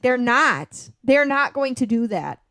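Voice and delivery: mock exasperation. Co-host retells reply to gullible commenters, exasperated.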